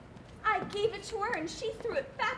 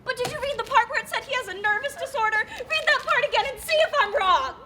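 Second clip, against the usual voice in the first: louder and higher, pushing hard; loud insistent plea; 7 semitones higher, 8 dB louder than usual. Acted. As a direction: demand he reread it. Pleading insistently, rising.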